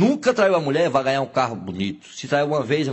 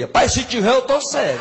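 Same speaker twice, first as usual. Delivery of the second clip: gruff retort; 8 semitones higher, 4 dB louder than usual. Acted. Seu Lunga rude retort.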